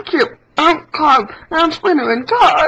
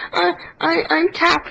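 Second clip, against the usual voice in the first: usual speaking voice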